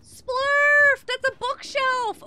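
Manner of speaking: loud high-pitched yell; shouting. Real, not acted. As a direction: yell across the room at a friend. Yelling at teammate in game.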